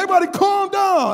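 put-on high, loud kid taking charge; confident little kid. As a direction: shout it out like a sure-of-himself child. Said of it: "Five-year-old kid said".

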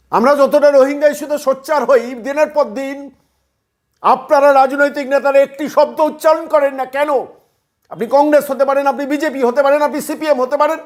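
high, loud, indignant shouting at politicians; furious tirade at party leaders; angry outcry. Real, not acted. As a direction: hammer the accusation home at full volume. Ranting at silent political leaders.

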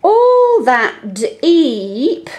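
stretched out very slowly. Shown as a demonstration. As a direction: draw every word out so the E can be heard. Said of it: "you might do it slowly".

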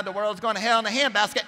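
mock alarmist churchgoer, pitched high; finger-wagging scold. Pew-sitters scolding the world, mock finger-wagging outrage. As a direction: wag your finger in a high, put-on lament. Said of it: "wag their finger at the world going".

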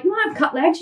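fast, disbelieving repeat of "wanna"; incredulous echo. Real, not acted. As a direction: throw it back in disbelief. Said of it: "I'm like".

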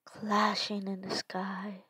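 soft, hushed storytelling voice; story narration. A narrator's reading. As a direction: read it like a dark tale. Dark story narration read aloud.

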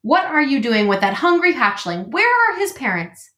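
indignant goose, rising high on the last question; scolding old goose; squawking goose. A narrator's reading. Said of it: "squawking to the robot".

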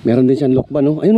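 normal talking voice

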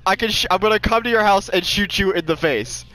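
high pitch, breaking up with laughter; in tears laughing. Real, not acted. Gamer retells a rival's threat to friends, in tears laughing. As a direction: fight through laughter to get the words out. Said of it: "I'm in tears right now".

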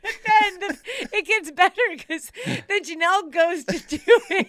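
very high, loud, laughing voice; helpless laughter. Real, not acted. Telling how her sister failed too, cracking up. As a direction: struggle to get the story out through laughter.

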